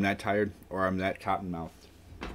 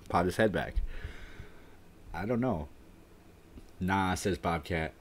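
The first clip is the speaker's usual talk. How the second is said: ordinary delivery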